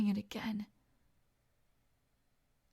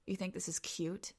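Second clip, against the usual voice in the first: normal talking voice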